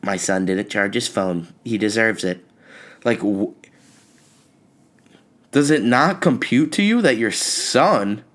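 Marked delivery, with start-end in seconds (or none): 0.0-2.4 lower, softer smug mother; cold mom. Retold or imitated. Son imagining his mom's smug thoughts, quietly cold. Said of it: "In her head, she's like".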